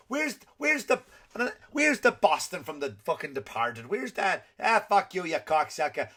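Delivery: hammed-up, cliched Boston accent; horrible Boston accent. Visitor hunting the Boston from The Departed, mock Boston accent. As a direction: do a bad movie-style Boston tough in Boston accent. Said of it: "that's a cliche, horrible accent that I've just done".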